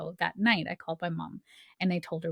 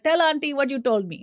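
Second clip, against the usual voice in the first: high, louder mom voice; lightly voiced mom prompting; mom's urging voice; 8 semitones higher, 7 dB louder than usual. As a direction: nudge like a pushy mother.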